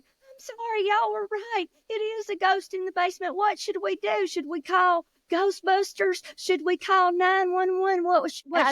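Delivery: high, fast frantic squeal; panicked mama. Acted. Mama runs from basement, high frantic babble. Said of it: "She's like".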